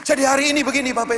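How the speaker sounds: loud, fervent sermon over music; full-force preaching. Acted. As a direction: preach it out, pushing the congregation. Preaching climax to amen call.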